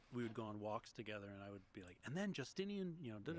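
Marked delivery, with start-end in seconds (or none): 2.1-3.4 higher-pitched imitation of an excited younger self; eager nonstop rambling. Walking with older brother about the Byzantine Empire, eager rambling. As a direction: imitate gushing on and on, pitched well up. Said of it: "I would not shut up".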